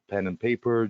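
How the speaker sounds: ordinary talk, nothing marked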